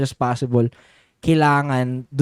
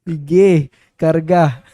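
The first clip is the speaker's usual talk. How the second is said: louder playful urging to load up; mock egging on; 3 semitones higher, 6 dB louder, much slower than usual. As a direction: egg on a lifting buddy, raised voice. Acted egging on to lift more. (pabirong panunulsol, malakas)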